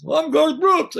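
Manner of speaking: indignant mock yell, high and loud. Star to the roadie who yelled at him, loud retort. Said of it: "things like [name]".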